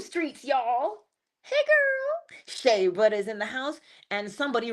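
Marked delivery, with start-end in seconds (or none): 1.4-2.4 much higher, rising; greeting a friend in chat, much higher; excited greeting. Real, not acted. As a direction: squeal a hello.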